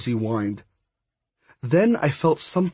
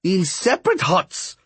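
higher, firm character voice; pointed reminder; 6 semitones higher than usual. A narrator's reading. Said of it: "Miss Cab reminded him".

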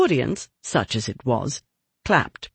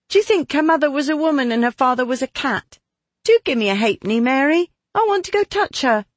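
much higher, fast, eager begging; eager young girl; 10 semitones higher, faster than usual. Acted. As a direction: beg for it like a keen girl. Diana begging for a halfpenny.

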